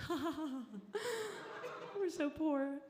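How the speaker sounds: mock fake laugh. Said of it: "we're all like".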